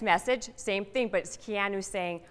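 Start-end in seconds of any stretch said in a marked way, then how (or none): none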